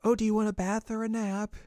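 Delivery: high, singsong mother voice; doting mom. Retold or imitated. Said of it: "Her mom's like".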